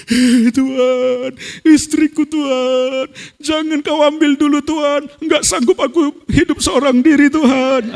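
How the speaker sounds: high, wailing plea to God; re-enacted crying. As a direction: wail the prayer in drawn-out mock sobs. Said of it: "Sambil nangis-nangis, saudara, begini". (ratapan doa sambil menangis)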